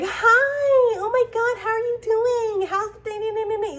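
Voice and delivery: high, gushing fake-sweet hello; fake friendly greeting. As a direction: overdo the phony cheer for someone you dislike. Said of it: "you're not gonna sit here and be like".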